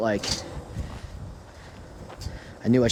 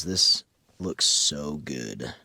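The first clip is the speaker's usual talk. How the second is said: low, slow, awed hush; in amazement; 8 semitones lower, much slower than usual. Shown as a demonstration. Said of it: "we're just gonna do this in amazement".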